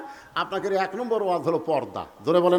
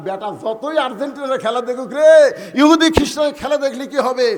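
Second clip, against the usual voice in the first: raised, loud sermon declaration; full-force preaching; 3 semitones higher, 7 dB louder than usual. Acted. Preacher declares father and son heaven-bound, full-force preaching. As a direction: proclaim it and rouse the crowd.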